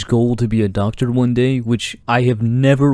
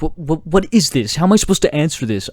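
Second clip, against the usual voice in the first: retold anger; 5 semitones higher than usual. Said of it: "I got so angry".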